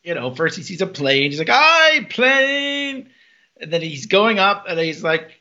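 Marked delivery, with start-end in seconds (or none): 1.5-3.1 high, loud called-out hello; gleeful hammed-up shout in a higher pitch; excited mock greeting. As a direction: call up to a passing plane, imitating him. Character greets a plane mid-flight.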